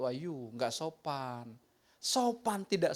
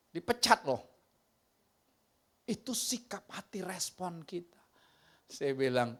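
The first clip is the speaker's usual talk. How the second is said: normal talking voice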